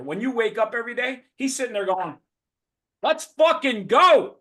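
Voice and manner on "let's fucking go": loud, high, drawn-out hype yell; cheerleader shout. Acted. God cheering you on as you wake up, loud hyped shout. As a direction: roar it out slowly like the biggest cheerleader. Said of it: "God's your biggest cheerleader".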